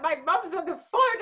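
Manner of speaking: high, drawn-out comic wail; half-laughing, half-crying plea; mock panic. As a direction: wail it in hammed-up horror. Retold panic as mum rings in.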